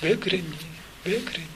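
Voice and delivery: Jesus softly accepting Saul, tender and hushed. Retold or imitated. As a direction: ask as if already forgiving. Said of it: "받아주는 음성으로".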